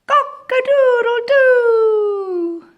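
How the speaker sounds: very high, loud, slow crow; rooster crow. Pretend. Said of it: "what a rooster says".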